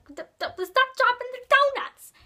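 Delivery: high, loud yell at a clumsy friend; exasperated shout. Retold or imitated. Shouting at Jermaine for dropping donuts, exasperated yell. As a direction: snap at him to quit dropping them. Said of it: "I'm like".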